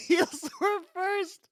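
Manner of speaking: high, slow laughing echo. Real, not acted. Laughing repeat of the line.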